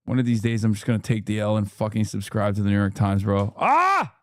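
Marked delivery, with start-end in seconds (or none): 3.6-4.2 sudden high frustrated cry; very high short cry of frustration; frustrated yelp. Real, not acted. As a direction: let out a sharp annoyed yelp. Frustrated by paywall.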